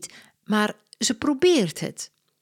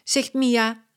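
ordinary delivery, nothing marked

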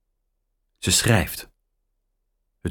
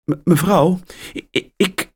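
higher, halting stutter; stammering girl; 11 semitones higher than usual. A narrator's reading. Mia stammers to the angry caller. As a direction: stammer flustered. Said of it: "begint Mia".

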